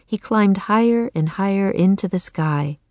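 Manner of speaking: ordinary delivery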